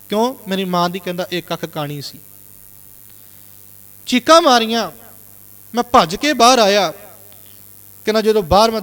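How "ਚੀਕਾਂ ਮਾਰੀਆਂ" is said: normal talking voice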